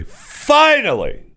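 "finally" stretched slow and very high; a long, high, relieved "finally". Acted. Emphatic relief.

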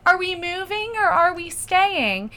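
high, slow, drawn-out asking; acted uncertain question. Asking boyfriend down the row, high slow helpless voice.

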